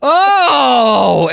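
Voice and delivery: hammed-up mounting realisation; dawning realisation. Dad's dawning realisation, acted.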